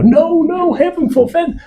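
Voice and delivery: high falsetto mock horror; hammed-up shocked falsetto protest. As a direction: squeal it like a scandalised aunt. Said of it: "Feel free to jump in and go".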